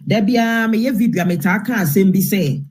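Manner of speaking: normal talking voice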